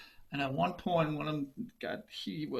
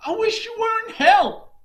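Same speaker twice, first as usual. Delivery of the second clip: much higher, much louder angry yell; furious curse; 13 dB louder than usual. Acted. Farmer curses the stuck horses in a furious yell. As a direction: explode at the horses.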